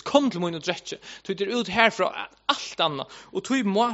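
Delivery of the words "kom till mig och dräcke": normal talking voice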